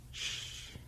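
unvoiced hush; whisper. A narrator's reading. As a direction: breathe the shh out without voice. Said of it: "she whispered without turning around".

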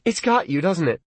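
turning him down gently but firmly; Lee's voice. A narrator's reading. Girlfriend refusing boyfriend on the phone, firm.

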